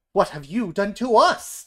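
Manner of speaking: loud, high demand with rising end. A narrator's reading. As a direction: shout it right back. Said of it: "demand the other animals".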